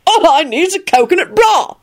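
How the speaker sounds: high, gleeful announcement; happy bellow. A narrator's reading. Arthur in his table runner, happy bellow. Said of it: "he bellowed happily".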